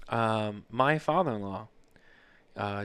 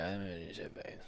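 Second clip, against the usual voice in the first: much softer, low mumble; imitating drunk wife; 5 semitones lower, 15 dB quieter than usual. Husband imitating his wife getting drunk in Ireland. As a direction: slur it sheepishly. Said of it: "You're like".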